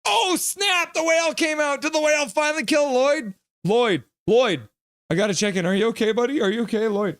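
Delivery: high, loud, thrilled yelps; excited. Real, not acted. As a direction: burst out at the whale on screen. Whale appears, checks on Lloyd.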